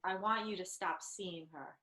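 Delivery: soft acted line. Wife quietly confronting her husband in a movie scene.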